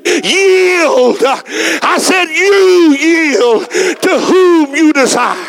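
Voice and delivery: rising sermon delivery with slow drawn-out peaks; preaching. Acted. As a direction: preach it, stretching the key words.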